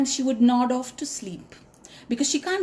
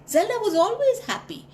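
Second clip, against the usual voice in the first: higher, quick and bubbly; bright and happy; 10 semitones higher, faster than usual. A narrator's reading. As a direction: bounce up on happy.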